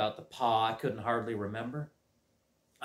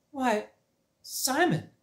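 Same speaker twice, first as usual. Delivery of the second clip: much higher, gently startled; surprised teacher; 11 semitones higher, slower than usual. Acted. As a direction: greet him with mild surprise.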